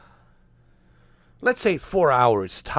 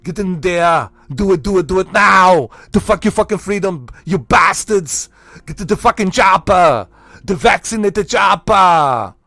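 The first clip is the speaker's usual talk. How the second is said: put-on loud, high action-hero bellowing with an accent; Arnold; 5 semitones higher, 10 dB louder than usual. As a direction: shout it like an over-the-top movie commando. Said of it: "Has a Arnold and a chopper".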